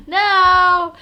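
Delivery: a high, loud, hammed-up plea to be spared; mock panicked pleading. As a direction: shriek and beg, playing up the fear. Begs not to be hung up.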